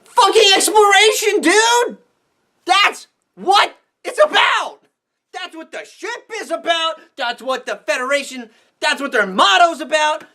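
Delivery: rant shout. Real, not acted. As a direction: shout it at the screen. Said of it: "fan ranting about the mission".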